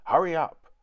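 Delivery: urgent call. A narrator's reading. Housekeeper hurries children.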